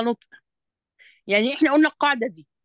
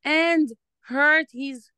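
slow reading aloud; 4 semitones higher, much slower than usual. Shown as a demonstration. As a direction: read it out slowly for the learner. Reading the script aloud slowly. (قراءة بطيئة للطالبة)

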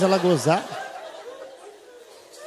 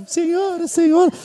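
high calling out; 10 semitones higher than usual. Acted. Son at the door calling out, high mock voice. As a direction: call out in a small high voice.

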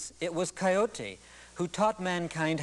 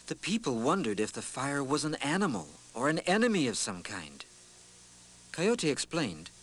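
epic fantasy narrator; 4 semitones lower than usual. A narrator's reading. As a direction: tell it like an old legend. Storyteller narrates a legend.

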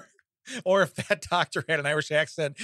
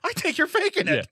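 high-pitched, hammed-up suspicion; mock complaining viewer; 14 semitones higher than usual. Host as a doubting TikTok viewer, mock indignant. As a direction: complain way up high, stretching the words.

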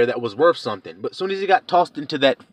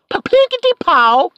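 mock impact noise; 6 dB louder than usual. Sound effect of being booted.

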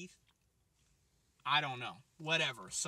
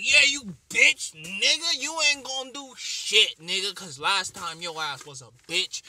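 cussing out; 3 semitones higher, 10 dB louder than usual. Retold or imitated. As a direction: tear into her loudly. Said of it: "She's like".

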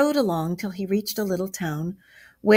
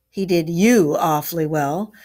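louder, heated retort; 6 dB louder than usual. A narrator's reading. Badger hotly rebukes Rat for being fooled, heated. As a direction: fire it back hotly. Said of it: "replied the Badger hotly".

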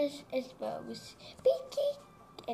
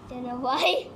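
normal talking voice

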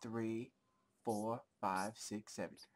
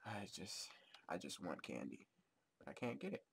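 ordinary talk

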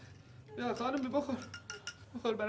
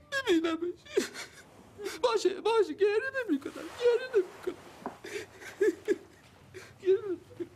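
higher and higher through tears, insisting he won't cry; crying denial; 9 semitones higher than usual. Acted. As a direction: sob out that you're not crying.

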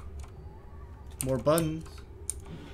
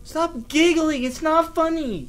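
high, loud frightened pleading; scared whine; 13 semitones higher, 5 dB louder than usual. Real, not acted. Gamer pleading with giggling Granny, high frightened whine. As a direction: beg the giggling granny to stop, terrified. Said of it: "Okay, I'm scared".

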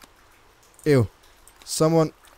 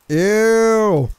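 disgusted; 6 semitones higher, 6 dB louder than usual. Real, not acted. Grossed out by game scene.

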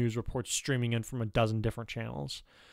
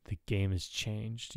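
softer, slow, lowered voice stressing game; lowered tones; 6 dB quieter, much slower than usual. A narrator's reading. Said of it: "speaking in lowered tones".

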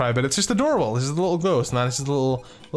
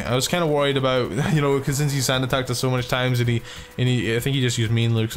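ordinary talk, nothing marked

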